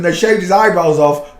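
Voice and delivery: usual speaking voice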